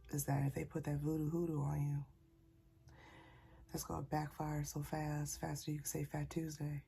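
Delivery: ordinary talk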